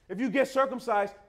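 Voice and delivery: usual speaking voice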